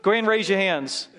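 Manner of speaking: usual speaking voice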